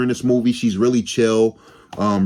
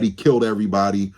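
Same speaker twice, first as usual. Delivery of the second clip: ordinary talk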